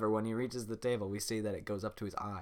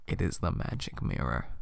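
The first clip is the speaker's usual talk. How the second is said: dramatic narration; 8 semitones lower, 5 dB louder than usual. A narrator's reading. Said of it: "a really dramatic line in my head".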